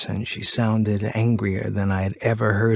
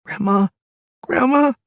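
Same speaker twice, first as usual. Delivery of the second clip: high, loud cry, rising higher; child screaming; 4 dB louder than usual. Retold or imitated. Terrified boy screaming for grandma up the stairs, high loud call. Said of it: "and began screaming".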